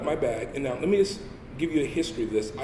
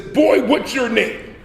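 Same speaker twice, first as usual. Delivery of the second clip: slow, loud, higher and looming; menacing sergeant; 7 semitones higher, 6 dB louder, much slower than usual. Retold or imitated. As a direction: loom over him and drag the words out. Drill instructor confronts recruit.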